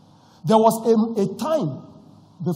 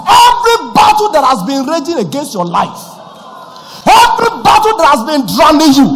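booming, much louder proclamation; preaching at full force; 10 semitones higher, 17 dB louder than usual. Real, not acted. Pastor declares victory over the congregation, thunderous preaching.

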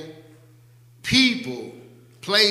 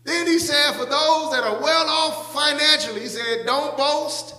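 high-pitched pulpit declamation; preaching; 11 semitones higher than usual. Real, not acted. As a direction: preach it with a raised, carrying pitch.

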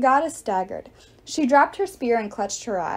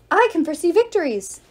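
loud, higher goddess yell; 7 semitones higher, 5 dB louder than usual. Acted. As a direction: shout your power at them. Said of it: "Nike yelled".

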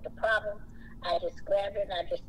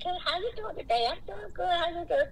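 friendly greeting; 9 semitones higher than usual. Retold or imitated. Acted greeting with shoppers.

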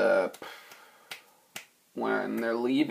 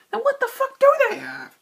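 a much higher, louder mock-outraged burst; hammed-up frustrated demand, much higher and louder; exasperated yell; 7 dB louder than usual. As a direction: blow up in an indignant parody shout. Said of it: "where he's like".

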